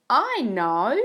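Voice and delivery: sudden bright "I know" shouted high and loud; big expressive storybook eureka; excited realisation. Shown as a demonstration. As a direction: burst out with the idea. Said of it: "make sure that you use expression".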